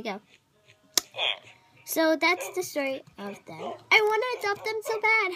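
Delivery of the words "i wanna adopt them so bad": excited. Real, not acted. Gushing over the cute puppies.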